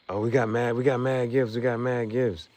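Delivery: high, loud thrilled exclaiming; light up at the surprise gifts; real excitement. Real, not acted. Streamer thanks chat for gifts, thrilled and loud.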